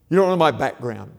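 loud, indignant protest; defensive outburst. Pretend. Imagined bitter listener objecting.

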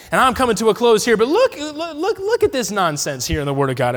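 usual speaking voice

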